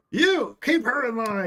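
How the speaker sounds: high, loud gruff command; barking order. Pretend. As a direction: bark the order at the old woman. GM voices Gerald yelling orders.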